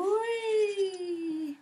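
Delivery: a high, playful wee. Pretend. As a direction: squeal a high wee as if sliding down. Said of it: "and you're gonna go".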